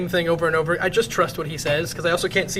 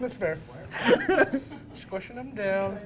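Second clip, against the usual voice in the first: mouth full; 2 semitones higher, 5 dB quieter than usual. Real, not acted. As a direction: mouth crammed with marshmallows. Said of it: "He's just chipmunking it".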